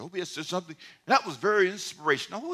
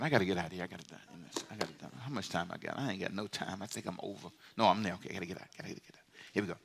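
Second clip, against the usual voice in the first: much lower, much softer muttered aside; off-mic aside; 11 semitones lower, 11 dB quieter, faster than usual. Real, not acted. Pastor asking staff about time, quiet and low. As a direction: check the time with staff under your breath.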